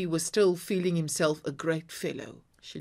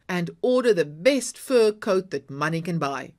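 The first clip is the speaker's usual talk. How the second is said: crying out; 8 dB louder than usual. A narrator's reading. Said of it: "he cried".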